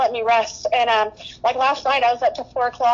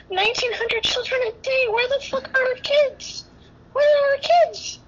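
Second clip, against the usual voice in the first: anguished cry; 10 semitones higher than usual. Real, not acted. Anguished outburst about missing children.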